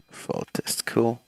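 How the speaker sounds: normal talking voice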